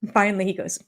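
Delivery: ordinary delivery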